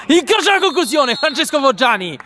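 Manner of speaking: excited commentary. Real, not acted. Shot commentary.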